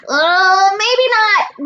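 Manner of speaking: high, loud alarmed backtrack; hasty correction. A narrator's reading. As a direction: take it back in a high yelp. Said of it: "Grover corrected".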